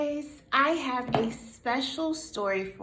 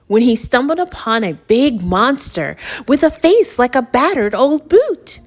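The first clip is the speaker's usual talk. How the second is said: loud, animated picture-book reading; story narration; 3 semitones higher, 13 dB louder than usual. A narrator's reading. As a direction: punch the rhymes for young listeners. Reads picture book aloud.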